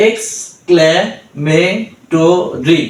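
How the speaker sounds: Exclamatory drawn out slowly, syllables joined; slow blended word. Shown as a demonstration. Tutor blends the drilled syllables for learners, slowly. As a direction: stretch exclamatory slowly, each syllable clear. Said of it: "তাহলে কি হলো?".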